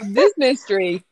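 ordinary delivery

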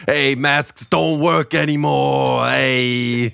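loud, slow, high Brooklyn yell; Brooklyn accent. Retold or imitated. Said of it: "almost more Brooklyn".

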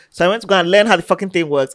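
normal talking voice

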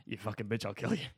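a hint of South African accent. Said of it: "developed a South African accent".

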